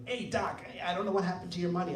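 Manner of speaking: lightly voiced mayor, high and fast; a quick, high, carefree take on the mayor; mock mayor brushing off the lost check; imitating the mayor. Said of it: "the way he talks is so funny".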